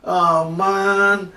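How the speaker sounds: high, loud, drawn-out wail of complaint; loud groaning. A narrator's reading. Jonah waking to a dead tree, loud groan. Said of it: "he groaned".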